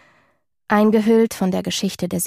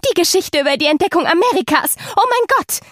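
high delighted squeal; squealing with joy; 10 semitones higher, 3 dB louder than usual. Acted. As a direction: squeal it out in pure glee. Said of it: "quietschte sie vor Freude". (vor Freude quietschend)

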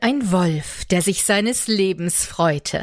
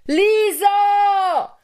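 calling out; 12 semitones higher than usual. Acted. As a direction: call her from far away. Calling out across the forest. (lauter, hoher Ruf nach Lisa)

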